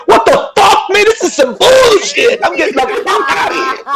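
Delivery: high-pitched, acted outrage; hyped-up freakout. Player imagines own reaction to the dragon, hyped-up panic. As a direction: play a worked-up urge to bolt. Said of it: "I'd be hype as fuck".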